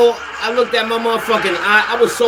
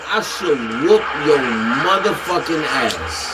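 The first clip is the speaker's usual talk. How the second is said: low threat; 9 semitones lower than usual. Retold or imitated. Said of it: "I said".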